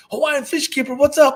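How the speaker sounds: imitated fan calling out, pitched high; excited fan greeting. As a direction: call out like you've spotted your favorite YouTuber. Imitating a fan spotting him.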